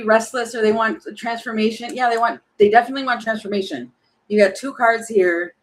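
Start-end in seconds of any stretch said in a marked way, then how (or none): none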